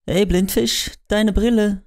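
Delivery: high, bright call from a distance; called from far off. Acted. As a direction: shout across the room, teasing. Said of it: "kam es von weit her".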